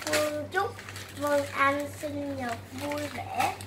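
slow syllable-by-syllable reading; slow reading aloud. Real, not acted. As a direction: sound out the card word by word. Child reads a card aloud.

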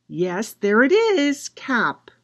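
usual speaking voice

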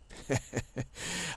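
short self-satisfied chuckle; smug chuckle. Acted. Boastful friend amused at himself, smug chuckle. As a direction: chuckle to yourself, lightly. Chuckling in character as Juan Carlos.

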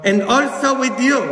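loud congregation response. Retold or imitated. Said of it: "todo el mundo respondió".

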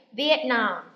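"Vietnam" loud, as viet-NAM; stress on last syllable. Shown as a demonstration. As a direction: model "Vietnam" with a strong falling Nam. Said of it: "Nhấn vần phía sau của chữ Nam".

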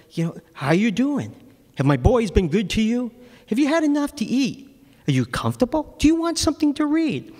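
sugary fake politeness, pitched high; mock friendly jailer. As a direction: lull the prisoner with sweetness. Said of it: "said to Thomas".

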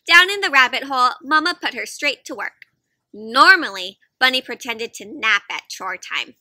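put-on lively read-aloud voice for kids; picture-book reading; storytime narration. A narrator's reading. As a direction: tell it to a circle of little listeners.

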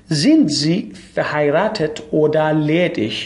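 very slow, deliberate German question; slow model question. Shown as a demonstration. Teacher modelling for students, slow careful question. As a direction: spell the question out word by word. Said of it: "teacher models German question".

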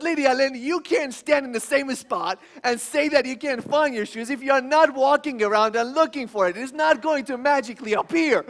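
lost it. Retold or imitated. Father losing his temper at his daughter over shoes, loud. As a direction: scold like a parent at the end of his rope. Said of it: "So I lost it".

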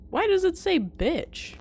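high, drawn-out incredulous question; slow, high-pitched bafflement; shocked question. Real, not acted. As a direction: ask in loud disbelief, pitch soaring. Shocked at game text.

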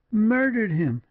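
high, shocked outcry in a woman's voice; aghast, high-pitched exclaim; horrified exclamation. A narrator's reading. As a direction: cry it out, pitch leaping high in horror. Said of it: "exclaimed Julia".

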